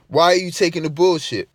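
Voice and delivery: normal talking voice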